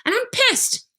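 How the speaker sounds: high, mock-angry complaint; mock upset. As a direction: squeak it out, playfully upset. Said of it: "at the end you say".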